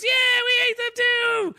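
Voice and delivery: high, slow hammed-up crowd yell; mock crowd shout. Crowd answering the wrestler, high mock yell. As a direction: yell back like a whipped-up crowd. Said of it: "Everyone goes".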